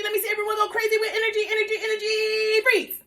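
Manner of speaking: put-on high, excited, revving the kids up; energetic hype. Shown as a demonstration. Teacher getting the class to go crazy with energy. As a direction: pump up the energy.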